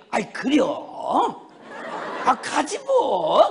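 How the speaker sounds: slow, stretched Chungcheong drawl; wily Chungcheong nonchalance, dragging the words; easygoing Chungcheong dialect. Retold or imitated. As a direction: imitate an unbothered Chungcheong mother. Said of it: "충청도 말로 느물느물해".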